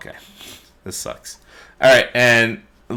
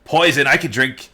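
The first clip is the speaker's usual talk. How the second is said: lightly voiced dwarf, pitched higher; hint of a Dwarven character, raised pitch; 6 semitones higher, 4 dB louder than usual. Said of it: "it's actually a Dwarven, Dwarven voice".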